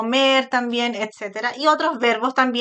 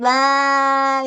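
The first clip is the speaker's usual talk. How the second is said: funny-face ah, louder; a playful exaggerated ah; loud silly ah; 3 semitones higher, 5 dB louder than usual. Shown as a demonstration. Therapist demonstrating crazy faces for children, loud silly ah. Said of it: "Empezamos con las caras locas".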